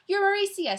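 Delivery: high, loud Scottish-tinged exclamation; name them with a Scottish touch; lightly Scottish storybook boy; Scottish gentleman. A narrator's reading. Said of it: "Jason sounded like the gentleman from Scotland".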